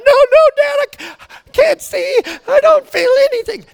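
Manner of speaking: very high, wailing child imitation; mock crying. Stuck daughter who can't see the ground, wailing in panic. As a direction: squeal like a terrified kid in tears. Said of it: "while they're crying".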